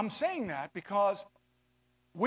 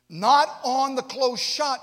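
not on the close shot drawn out slow and loud; slow, loud, emphatic warning; stressed phrase; 5 semitones higher, 10 dB louder, much slower than usual. Acted. Preacher stresses the point.

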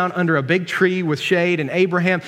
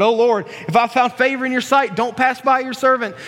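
higher-pitched reverent plea; pleading quote; 6 semitones higher than usual. Acted. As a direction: read it as an earnest appeal. Reads Abraham's plea to the Lord.

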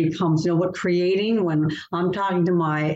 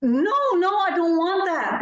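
higher, emphatic no-no refusal; mock refusal; 11 semitones higher than usual. Author recalling her first thought about the cover, emphatic refusal. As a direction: push it away, pitched well up. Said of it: "my thought was".

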